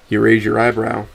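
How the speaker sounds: usual speaking voice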